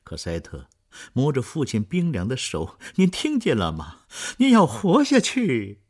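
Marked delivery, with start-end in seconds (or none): 3.0-5.8 loud, high, slowing cry; desperate plea. Acted. Cosette holding her father's cold hand, desperate loud plea. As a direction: beg him to hold on.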